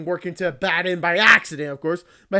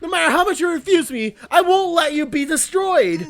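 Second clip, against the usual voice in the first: determined outcry; 6 semitones higher, 3 dB louder than usual. Acted. Character refusing to give up.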